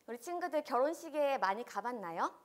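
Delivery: ordinary delivery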